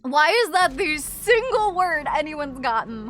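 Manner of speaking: ordinary talk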